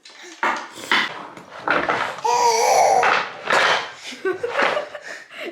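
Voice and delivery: loud high squealing laugh; delighted high laugh. Real, not acted. Big laugh with baby.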